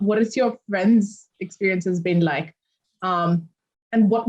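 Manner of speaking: ordinary talk, nothing marked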